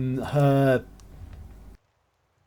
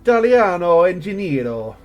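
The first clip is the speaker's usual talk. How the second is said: put-on Italian accent; 3 semitones higher, 7 dB louder than usual. Gamer reading Italian names in a hammed-up Italian accent. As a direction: overplay an Italian accent with a laugh. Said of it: "Apologies for the accent".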